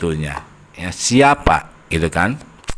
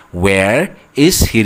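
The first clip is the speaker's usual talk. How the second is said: slow model question; much slower than usual. Shown as a demonstration. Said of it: "teacher models the wh-question".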